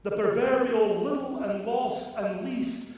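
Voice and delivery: high, loud, fervent preaching; impassioned preaching. Acted. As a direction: preach it with rising fire.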